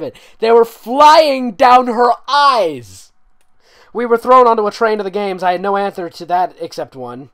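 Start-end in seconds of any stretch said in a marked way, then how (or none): 0.4-5.1 much higher, louder, slow hammed reading; mocking overread. Mocking the fanfic's tears line, hammed slow reading. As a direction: drag it out to mock the prose.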